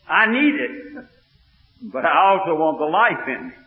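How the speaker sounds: loud, high, driving sermon voice; fired-up revival preaching; preaching at full force. Acted. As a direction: preach it to the back pew. Preacher rises again.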